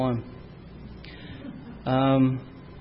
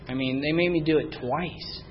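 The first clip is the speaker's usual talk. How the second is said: normal talking voice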